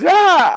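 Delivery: sudden high, loud "ah"; mock yell. Speaker parodies how Turkish sounds, high mock yell. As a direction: burst out in a parody cry.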